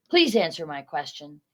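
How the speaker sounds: ordinary delivery, nothing marked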